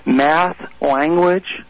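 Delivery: normal talking voice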